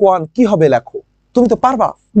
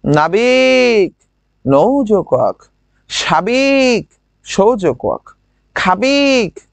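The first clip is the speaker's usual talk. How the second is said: slow drill; slower than usual. Shown as a demonstration. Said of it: "বলো দেখি".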